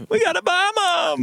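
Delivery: Jerry Seinfeld impression. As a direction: pile onto the joke in a silly high voice. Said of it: "Jerry Seinfeld".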